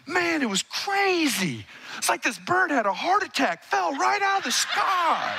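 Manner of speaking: high, slow, amazed retelling; mock Mark marveling at the bird; imitating Mark. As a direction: play the amazed bandmate telling it. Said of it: "he's telling the story".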